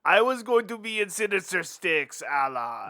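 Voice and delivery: whiny mock-crying character voice; hammed-up, wailing complaint; Watto. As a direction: lament it through fake sobs. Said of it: "Watto, what's up?".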